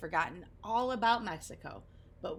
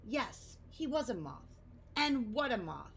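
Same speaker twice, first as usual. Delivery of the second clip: ordinary delivery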